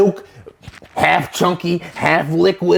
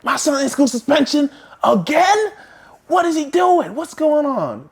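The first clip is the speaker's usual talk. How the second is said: upset mom; 10 semitones higher than usual. Retold or imitated. Mom confronting the principal, high and indignant. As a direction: demand answers like an angry mom. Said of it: "those couple times".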